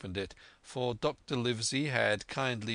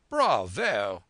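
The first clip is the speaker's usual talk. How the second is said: excited cry; 8 dB louder than usual. A narrator's reading. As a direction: cry out heartily in approval. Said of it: "and the squire cried".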